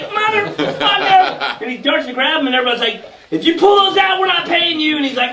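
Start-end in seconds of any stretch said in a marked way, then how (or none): 0.0-1.6 drawn-out high curse; shocked curse. Retold or imitated. Kid spotting two darts, slow high curse. 3.3-5.0 group shout. Retold or imitated. Everybody yelling at the kid.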